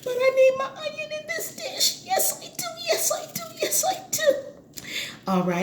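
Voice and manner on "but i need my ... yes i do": much higher, softer sing-song yes I do; playful high-pitched repeat; squeaky high insistence. Pretend. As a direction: tease it in a tiny high voice.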